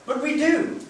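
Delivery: high, loud, bright reply; cheerful husband. Acted. Husband answering his wife with cheerful triumph. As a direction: gleefully contradict her. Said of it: "he replied".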